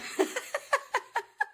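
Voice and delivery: high, helpless laughing fit; high giggle. Real, not acted. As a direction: giggle at the chaos. Laughs at her own mess.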